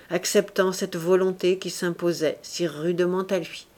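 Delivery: usual speaking voice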